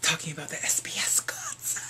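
hushed whisper. Acted. Vlogger whispering the concert topic. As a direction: say it under your breath.

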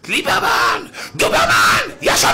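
full-throated imitated tirade; shouting. Rabbi tearing into Liberman in a retold attack, shouting. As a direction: yell the taunts out in short bursts. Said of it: "הוא צעק לו".

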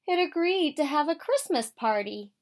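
plain reading voice

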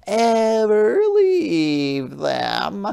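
high, slow, gentle crab voice; a small polite sea creature asking; Hermit Crab voice. Acted. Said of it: "thought Hermit Crab".

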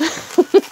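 high giggle of anticipation; giddy laugh. Real, not acted. Giddy before next item.

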